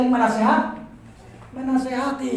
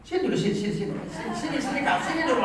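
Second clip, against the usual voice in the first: soft coaxing; 2 semitones lower, 7 dB quieter, much faster than usual. Retold or imitated. Wife coaxing husband to rest.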